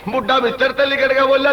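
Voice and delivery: normal talking voice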